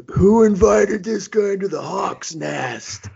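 put-on high, loud, drawn-out drunk heckle; mock drunken holler. Drunk fan hollering at the crowd, high mocking yell. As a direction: holler it slowly like a rowdy drunk.

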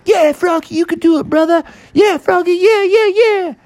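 high mock crowd cheer. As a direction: cheer him on like excited kids.

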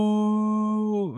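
very high, long drawn-out mock whoa; hammed-up surprise; crowd's whoa. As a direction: draw out a stunned whoa. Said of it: "everybody's like".

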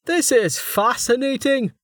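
high, slow, enthralled; fascinated scholar. A narrator's reading. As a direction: marvel in a high voice, drawing it out. Roma engrossed in the runes.

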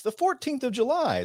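high mock movie line; Con Air quote. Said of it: "that movie Con Air, it kept mentioning".

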